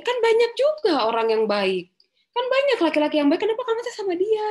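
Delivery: high, loud, fast disbelieving mother; incredulous mother. Retold or imitated. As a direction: press the child in disbelief. Retold parent-child exchange.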